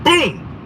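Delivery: loud, high imitated boom. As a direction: slam out a heavy thud. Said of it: "it sound like somebody dropped a weight".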